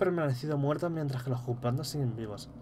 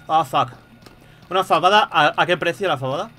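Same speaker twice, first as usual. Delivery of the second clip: surprised outburst; 4 semitones higher, 8 dB louder than usual. Real, not acted. Surprised by shop price.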